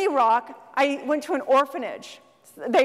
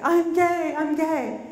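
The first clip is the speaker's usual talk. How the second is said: higher, a touch of mock tearful breaking; retold crying; 4 semitones higher, slower than usual. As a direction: act out his tearful confession lightly. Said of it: "he's crying".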